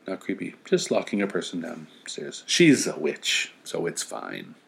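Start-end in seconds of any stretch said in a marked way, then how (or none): 2.5-4.5 deadpan sarcasm; mock reassurance, dropping low; sarcastic. Real, not acted. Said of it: "sarcastic aside".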